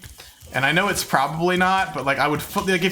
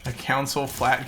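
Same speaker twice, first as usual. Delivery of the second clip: usual speaking voice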